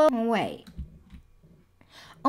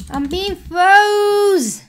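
high, loud, very slow wail; stretched dismayed wail; 10 semitones higher, 10 dB louder, much slower than usual. Real, not acted. Gamer frozen by a trap, high slow wail. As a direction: draw out the cry of alarm.

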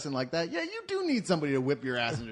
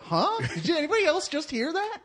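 stunned disbelief; 11 semitones higher than usual. Retold or imitated. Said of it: "I was like".

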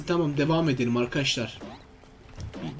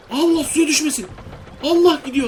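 high, louder cry of alarm; startled alarm; 8 semitones higher, 5 dB louder than usual. Real, not acted. Gamer scared the cargo will fall in the water, startled. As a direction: blurt it out in a sudden scare. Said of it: "korktum bir an düşecek diye".

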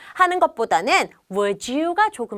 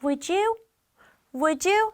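asking a favour with a pleading rise; teacher acting out a pleading request; 6 semitones higher, much slower than usual. Shown as a demonstration. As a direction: coax it like begging a favour. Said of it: "선생님 표정이 어때요? 막 부탁하는 표정".

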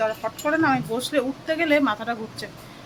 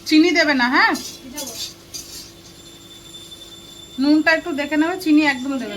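loud, raised voice calling across the house to the cook; 5 semitones higher, 5 dB louder than usual. Real, not acted. Calling to the cook in the kitchen, loud and raised. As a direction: call it out so the kitchen hears.

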